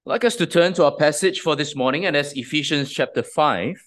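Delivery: ordinary talk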